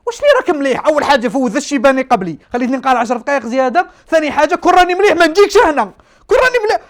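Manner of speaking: high, loud indignant comeback; outraged retort. Retold or imitated. Scared patient snapping back at doctor's "are you well?", outraged. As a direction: throw the question back in high-pitched outrage.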